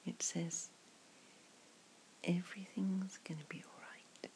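hushed ASMR whisper, close to the mic; soft whisper. Acted. To a friend at the pub, whispered ASMR comfort. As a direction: breathe the words softly like a secret.

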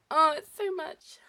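loud, high, overwhelmed gasp of words; passionate cry out. Acted. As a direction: let it burst out of you. Passionate climax build.

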